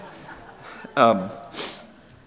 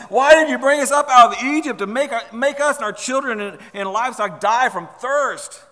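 high, loud mock outcry; complaining; 7 semitones higher, 6 dB louder than usual. Israelites complaining to Moses of thirst, high and loud. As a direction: complain bitterly as the aggrieved crowd. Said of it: "they can keep on complaining".

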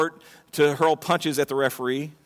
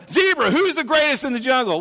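higher, pushy lion demanding an answer; swaggering lion; 8 semitones higher, 3 dB louder than usual. Acted. Lion to the zebra, demanding to hear he's greatest. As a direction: puff up like a proud lion.

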